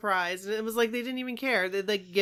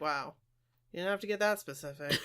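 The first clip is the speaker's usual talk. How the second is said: understated dismay; 6 semitones lower, 6 dB quieter than usual. Retold or imitated. Retelling own taken-aback reaction.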